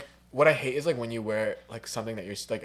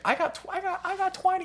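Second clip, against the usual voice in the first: much higher, put-on bragging voice; smug one-upper. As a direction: squeak out the brag to top them. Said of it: "They'll be like".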